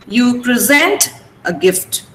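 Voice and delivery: slowly, with SENT stressed in present; slow example, verb present stressed at the end; second-syllable stress. Shown as a demonstration. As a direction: present as pri-ZENT. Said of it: "You have to stress sent".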